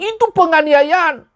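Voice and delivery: a slow, mocking imitation of people calling discipline abuse; mocking critics. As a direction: drag it out to mock the critics. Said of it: "Beberapa bilang".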